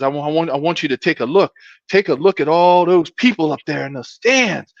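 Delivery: imitated North Carolina coach; North Carolina accent. Coach showing players the packed stands, imitated North Carolina accent. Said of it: "I'll try to emulate".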